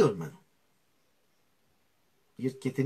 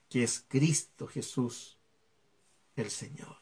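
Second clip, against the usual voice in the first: hushed prayer; 3 semitones lower than usual. Acted. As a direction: let the prayer sink to a hush. Prayer fades into hush. (oración suave que se apaga)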